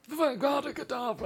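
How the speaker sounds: high, gasping Voldemort parody; wheezy Voldemort. Acted. Friends parody Voldemort casting spells, wheezy mock villain. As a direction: gasp out the spell like a breathless Voldemort. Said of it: "he has a breathing problem".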